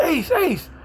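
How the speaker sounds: high-pitched mock yell of a name; imitated yelling. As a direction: call out high, imitating someone yelling after you. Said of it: "He yelling".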